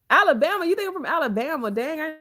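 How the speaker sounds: high, loud disbelief; real incredulous outburst, pitched high. Real, not acted. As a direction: react as if stung by a wild guess. Reacts to a viewer's accent guess.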